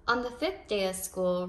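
ordinary delivery, nothing marked

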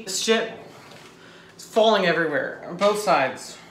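normal talking voice

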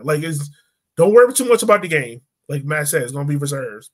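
normal talking voice

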